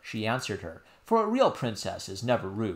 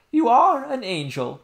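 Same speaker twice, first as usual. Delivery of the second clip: tender nurse cry; 12 semitones higher, 9 dB louder than usual. Acted. Nurse hugging the princess, crying out fondly. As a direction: gush over the child with love.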